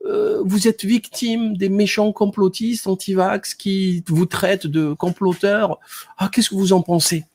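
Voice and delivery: high, hesitant fawning interviewer; mock fawning reporter voice, high; mocking journalist. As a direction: ape the journalist sucking up.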